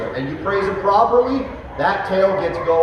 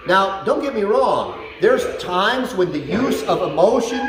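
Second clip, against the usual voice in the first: ordinary talk, nothing marked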